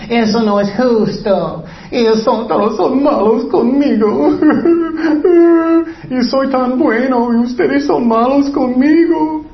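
whiny crying victim. Acted. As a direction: whimper like a wronged big baby. Cain whining like child.

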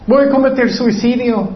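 high-pitched, hammed-up despair; mock self-pity. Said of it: "Él solamente sentía mal".

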